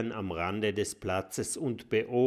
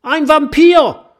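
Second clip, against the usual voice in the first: viel höher und lauter geschrien; aufgeregter Schrei; 16 dB louder than usual. Acted. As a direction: schreit panisch um Hilfe. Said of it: "schreit ein aufgeregter Stacheldrache". (aufgeregter Stacheldrache schreit es allen zu)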